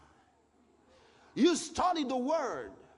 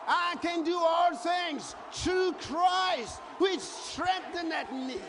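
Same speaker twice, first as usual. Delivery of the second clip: slow preached verse; 6 semitones higher, slower than usual. Acted.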